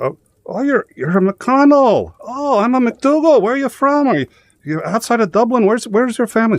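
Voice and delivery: Irish cop. Retold or imitated. An Irish cop quizzing the driver, in a high chummy Irish voice. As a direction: play the nosy Irish cop, all friendly small talk. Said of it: "He goes".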